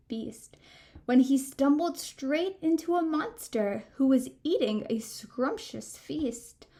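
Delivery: usual speaking voice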